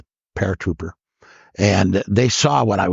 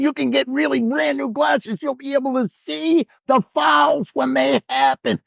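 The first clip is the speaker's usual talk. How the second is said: heckling the ref. Retold or imitated. Fan in the stands heckling the referee over a bad call, booming mock yell. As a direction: bellow it across the gym at the referee, much higher. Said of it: "I have a voice that would hit the whole gym".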